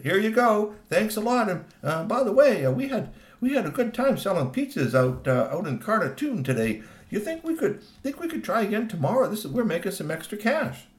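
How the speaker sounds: high, wheedling pitch to a pal; butter up the friend, voice lifted; eager, slightly halting request; hopeful favour-asking. Acted.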